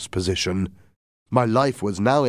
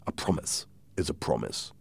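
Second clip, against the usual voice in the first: soft, lowered promise; quiet assurance; 4 semitones lower, 8 dB quieter than usual. Acted. Doctor assuring Jim, quiet and low. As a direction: say it hushed and low.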